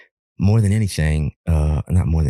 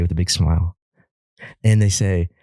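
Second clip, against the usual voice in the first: normal talking voice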